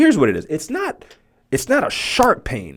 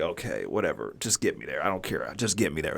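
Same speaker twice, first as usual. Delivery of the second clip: soft, worn-out grumble; weary mutter; 8 semitones lower, 11 dB quieter than usual. Retold or imitated. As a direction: mutter it, too tired to argue. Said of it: "And I'm just like".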